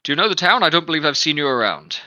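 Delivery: loud, high questioning captain; guard captain voice. Acted. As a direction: probe the stranger loudly.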